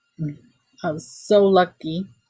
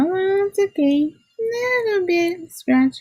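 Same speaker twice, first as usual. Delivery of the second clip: much higher and slow, sing-song relief; relieved high voice; 13 semitones higher, slower than usual. Retold or imitated. Checking dad's car for damage, high slow relief. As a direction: talk yourself into calm, high and drawn out.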